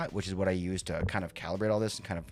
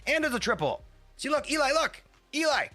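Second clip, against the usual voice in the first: much higher and louder, calling out "look"; excited shout; 5 dB louder than usual. Real, not acted. Streamer shouting at Eli over a triple, excited yell.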